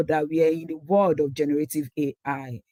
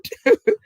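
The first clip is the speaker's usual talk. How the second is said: quick, high burst of laughter; short laugh; 8 dB louder than usual. Real, not acted. Presenter amused at calling T five old, short laugh. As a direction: let out a brief, high laugh.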